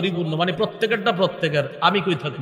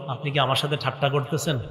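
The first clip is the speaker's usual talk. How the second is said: softer, lower version of the question; hushed disbelieving servant; quiet incredulous question; 4 semitones lower, 6 dB quieter than usual. Acted. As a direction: say it low and wondering. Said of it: "এই যে বলবে".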